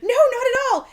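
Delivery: high, loud innocent denial; mom denying. Retold or imitated. Mom denying the airport trip, high innocent voice. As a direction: overplay the innocence. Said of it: "And she's like".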